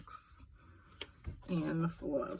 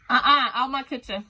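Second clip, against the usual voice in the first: loud, higher-pitched shooing; real scolding call; 7 semitones higher, 10 dB louder than usual. Real, not acted. Shooing kids out mid-reading.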